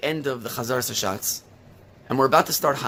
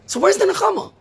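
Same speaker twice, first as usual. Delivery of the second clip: high, loud pleading question; impassioned cry; 5 dB louder than usual. Real, not acted. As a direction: cry it out to the congregation. Rhetorical cry in sermon.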